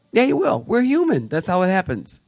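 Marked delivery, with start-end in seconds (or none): 0.1-1.3 high-pitched, knowing comeback; insistent rebuttal. Real, not acted. Pastor contradicting the congregation's claim, insistent. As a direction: push back in a raised, insistent pitch.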